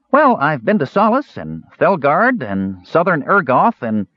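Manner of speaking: high, slow rambling list; chattering kender. A narrator's reading. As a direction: list places in a high little voice. Kender lists his travels.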